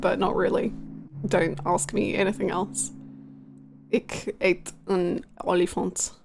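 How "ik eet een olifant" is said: slow, careful Dutch "Ik eet een olifant"; slow beginner Dutch; Dutch sentence. Shown as a demonstration. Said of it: "ik spreek een beetje Nederlands".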